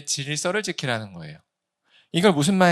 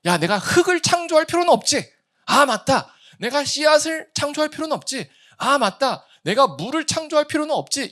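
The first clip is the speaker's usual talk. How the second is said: higher, louder 'oh right' realizations; realizing aloud; 10 semitones higher, 5 dB louder than usual. Retold or imitated. Preacher acts Adam realizing he need not create. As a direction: repeat each discovery with relief. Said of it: "그걸 보면서".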